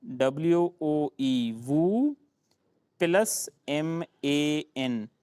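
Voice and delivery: slow letter by letter "डब्ल्यू ओ ई, वू, plus एम ए एन"; spelling out slowly. Shown as a demonstration. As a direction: spell the joke word out, letter by letter. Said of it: "एक है आपके पास वू".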